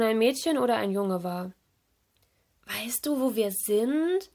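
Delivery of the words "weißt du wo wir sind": slow, puzzled question with a lifted end; confused. A narrator's reading. Lost mermaid asking her baby turtle, confused. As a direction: ask it slowly, at a loss. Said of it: "fragte sie verwirrt".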